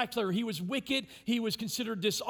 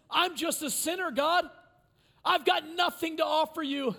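higher, louder desperate plea; pleading sinner; 7 semitones higher, 4 dB louder than usual. Acted. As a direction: confess it loudly to God. Voicing the tax collector's plea.

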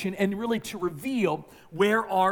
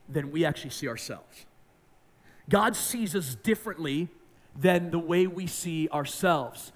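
normal talking voice

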